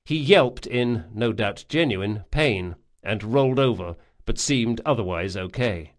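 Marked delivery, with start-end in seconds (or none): none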